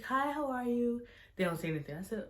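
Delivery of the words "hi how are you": high, drawn-out friendly greeting; nice waitress. Retold or imitated. Waitress greeting the table, nice and high-pitched. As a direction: imitate a sweet, high-pitched server. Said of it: "she was being so nice".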